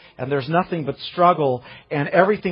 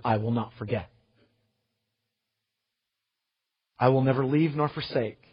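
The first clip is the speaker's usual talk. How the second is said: hushed promise; 4 semitones lower, 6 dB quieter than usual. Acted. God's promise quoted softly.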